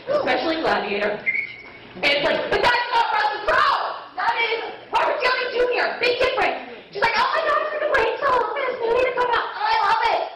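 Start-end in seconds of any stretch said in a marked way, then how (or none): 2.5-6.6 high-pitched, indignant mock protest; exasperated correction. Moviegoer corrects girl mistaking the actor, exasperated. 7.2-10.2 high, quick mock gushing; oblivious superfan excitement, hammed up; gushing fangirl. Girl keeps gushing about the movie, gushing.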